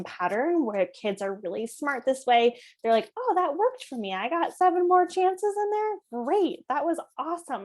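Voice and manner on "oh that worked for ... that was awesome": put-on high-pitched, self-satisfied kid voice; pleased kid. Kid who got seven more chances, imitated as pleased.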